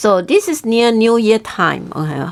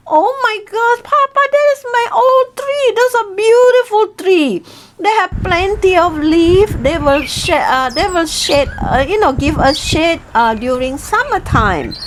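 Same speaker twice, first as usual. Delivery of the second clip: much higher, pleading wife's voice; wife begging husband to spare her tree, high and pleading; upset wife; 8 semitones higher than usual. Acted. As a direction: protest in a high wifely wail. Wife objects to cutting tree.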